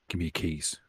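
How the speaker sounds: ordinary talk, nothing marked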